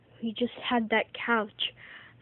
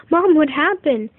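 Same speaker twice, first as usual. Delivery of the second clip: urgent question; 5 semitones higher, 9 dB louder than usual. Retold or imitated. Kids ask mom urgently.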